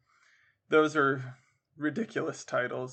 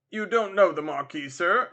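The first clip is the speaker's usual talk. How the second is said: louder, lightly voiced officer's question; military gentleman inquiring; 4 semitones higher, 6 dB louder than usual. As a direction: inquire with a touch of military authority. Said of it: "inquired the military gentleman".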